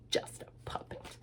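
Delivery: hushed whisper to the puppet. Acted. Lucy comforting her offended puppet. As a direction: whisper it apologetically.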